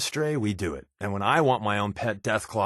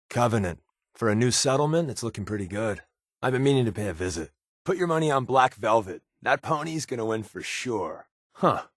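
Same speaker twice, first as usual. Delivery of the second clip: usual speaking voice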